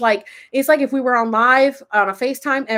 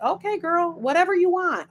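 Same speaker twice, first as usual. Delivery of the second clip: higher-pitched mock compliance; playful going along; 8 semitones higher than usual. Streamer giving in to her avatar, high playful agreement. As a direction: give in playfully, pitch lifted.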